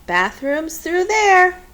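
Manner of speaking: a spry grandma pointing the way; grandma calling out. A narrator's reading. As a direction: call it out like a brisk old woman. Grandma voiced giving directions.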